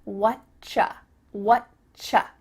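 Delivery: "What ya" split as "what, cha"; two-beat "wut-chuh"; ch sound. Shown as a demonstration. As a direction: model "What Cha" with a clear ch. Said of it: "sounds like a C H sound".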